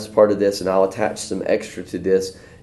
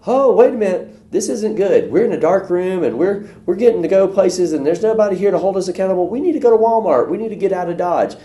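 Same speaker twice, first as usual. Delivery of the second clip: much higher, louder alarmed realization; sudden alarm; 10 semitones higher, 4 dB louder than usual. Retold or imitated. Teen in a dark room realizing they must leave, high alarmed. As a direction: snap awake to the danger.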